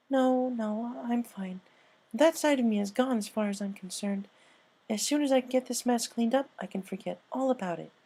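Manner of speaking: an overeager reassurance; Pinkie, insisting. Acted. Pinky insists she is fine.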